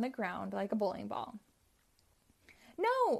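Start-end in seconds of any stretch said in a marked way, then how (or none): 2.8-3.2 high, loud cry of no; dismayed cry. Acted. Robotnik watching his robot break itself, high dismayed cry. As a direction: wail as the robot fails. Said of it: "cried Robotnik".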